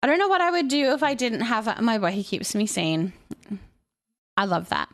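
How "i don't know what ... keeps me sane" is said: usual speaking voice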